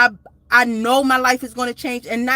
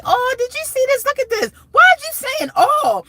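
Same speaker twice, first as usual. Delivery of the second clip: much higher squeaky mock voice; mocking imitation; 6 dB louder than usual. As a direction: mimic her own fussing in a high silly voice. Brother mocking her reactions.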